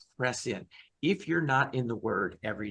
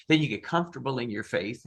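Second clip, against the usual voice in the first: normal talking voice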